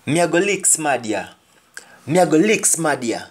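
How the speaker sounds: quick Jamaican patois threat, said twice; fast patois example line. Shown as a demonstration. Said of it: "a person will say".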